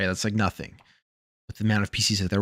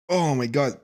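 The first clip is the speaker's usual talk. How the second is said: ordinary talk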